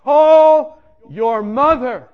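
a slow booming command that stops a man on a pier; commanding voice from above. Retold or imitated. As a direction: intone it like a voice from heaven. The voice repeats its command.

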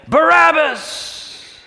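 high, loud, slow shouted name; crowd's shout. Acted. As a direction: bellow it back as the mob. Crowd answers Pilate.

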